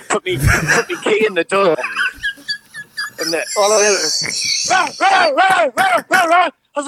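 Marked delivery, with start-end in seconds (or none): 4.7-6.5 loud barking imitation; dog barking. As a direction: bark like a big dog.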